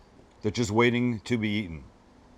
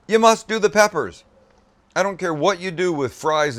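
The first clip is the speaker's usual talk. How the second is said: loud, high, emphatic; excited command; urgent insistence; 8 semitones higher, 6 dB louder than usual. Real, not acted. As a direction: insist hard on the peppers. Urging viewers after tasting.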